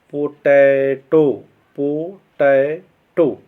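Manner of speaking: "पोटैटो" very slowly in three beats, पो-टै-टो; syllable by syllable. Shown as a demonstration. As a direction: stretch each syllable of "पोटैटो" for a class. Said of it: "इसमें three syllable का प्रयोग किए गए हैं". ("पोटैटो" के तीनों syllable अलग-अलग, खींचकर)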